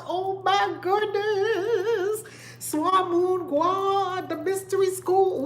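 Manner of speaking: high, slow, stretched delight; thrilled. Real, not acted. Telling viewers about the equinox trip, high and giddy. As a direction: savor the words with giddy awe.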